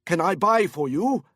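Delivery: put-on eager to please, Korean accent; tipsy Korean businessman. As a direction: drunk man offering drinks over loud music. Korean man character voice.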